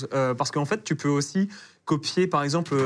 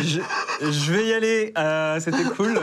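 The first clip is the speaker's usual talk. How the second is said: raised, louder voice breaking into laughter; laughing mock exit; 3 semitones higher, 3 dB louder than usual. Teased guest jokingly bows out of the interview, laughing. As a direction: pretend to leave, laughing. Jokes about leaving after accent tease.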